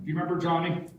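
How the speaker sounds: usual speaking voice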